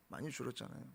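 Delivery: repeated quietly, falling away; hushed repetition of 많이 줄었잖아요; soft echo. Real, not acted. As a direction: let the echo of 많이 줄었잖아요 drop almost to nothing. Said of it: "repeated softer".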